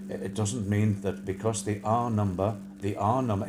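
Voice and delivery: low, halting monotone with repeats; droning official impression. Host mimics an official repeating himself, low drone. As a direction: drag it down into a droning statement. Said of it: "and that's how he talks".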